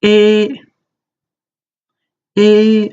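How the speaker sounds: eh high, loud and level; first tone eh. Shown as a demonstration. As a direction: hold eh high and flat. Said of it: "Okay, next one".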